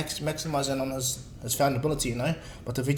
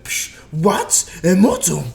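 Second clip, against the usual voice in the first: loud, higher outburst of disbelief; shocked disbelief; 4 semitones higher, 9 dB louder, much slower than usual. Acted. Goku learns of the wish from Bulma, loudly shocked. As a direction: burst out as if stunned by the news.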